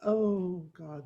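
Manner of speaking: ordinary talk, nothing marked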